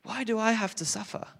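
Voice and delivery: put-on high, pleading teenage lament; distressed sixteen-year-old pleading with God; anguished crying out. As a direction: cry out to God in despair, pitch climbing. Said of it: "crying out".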